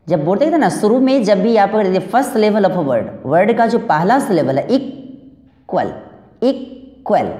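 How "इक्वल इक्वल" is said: Equal slowly broken after इक; teacher's two-part equal, syllable by syllable; split into syllables. Shown as a demonstration. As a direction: leave a clear gap inside equal, falling at the end. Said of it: "जहाँ पे word जो टूटता है".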